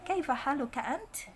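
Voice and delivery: "ant" ending in an airy t; breath-released t. Shown as a demonstration. Teacher repeats ta example, aspirated release. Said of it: "taknya itu dihamesnya keluar".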